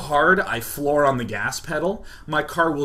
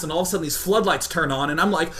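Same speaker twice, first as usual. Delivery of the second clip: ordinary delivery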